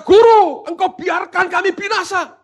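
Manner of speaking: high, loud cry of accusation; acted outraged yell; angry rebuke. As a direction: shout it at the teacher like panicked, furious disciples. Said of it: "Mereka marah. Yesus dihardik".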